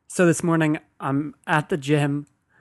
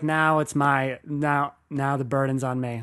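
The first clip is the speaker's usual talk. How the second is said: normal talking voice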